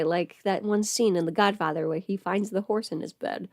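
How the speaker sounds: ordinary delivery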